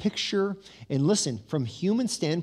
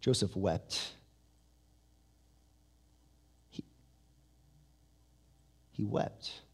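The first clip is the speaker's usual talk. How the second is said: hushed; 6 semitones lower, 9 dB quieter, slower than usual. Shown as a demonstration. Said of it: "pause, hushed after tears".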